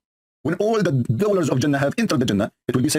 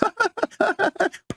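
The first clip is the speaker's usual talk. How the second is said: very high staccato cackle; high-pitched put-on "ha ha ha"; mock devil laugh. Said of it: "and he is laughing".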